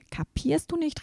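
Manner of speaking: a princess cries out in protest, pitched high; indignant cry. A narrator's reading. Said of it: "rief Perle".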